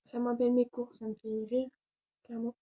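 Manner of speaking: normal talking voice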